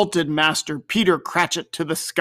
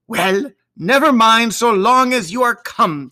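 smother her daughter with welcome; fond mother; 6 semitones higher, 5 dB louder than usual. A narrator's reading. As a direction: Mrs Cratchit greeting Martha fondly. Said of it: "said Missus Cratchit".

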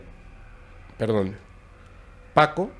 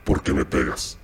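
muy grave y ronca, como poseído; voz súper ronca; 11 semitones lower than usual. Retold or imitated. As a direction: imita una voz ronca de ultratumba. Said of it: "en una voz súper ronca". (voz ronca y grave de poseído)

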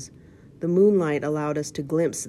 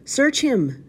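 high, loud villain's shriek; shrieked order; 10 semitones higher, 6 dB louder than usual. A narrator's reading. Blind man orders his gang inside, shrieking. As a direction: shriek the order at your men. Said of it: "shrieked the blind man".

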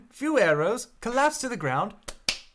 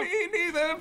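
nervous laugh; 8 semitones higher than usual. Acted. Character nervously agrees.

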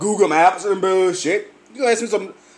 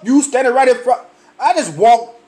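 ordinary delivery

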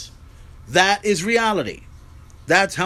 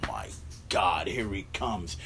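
much lower, soft, slow ominous murmur; low, quiet spooky mock dread; hushed awe; 11 semitones lower, 5 dB quieter, slower than usual. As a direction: drop the voice low as the monster returns. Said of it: "I see a hand coming out like".

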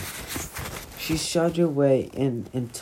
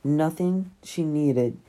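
ordinary delivery

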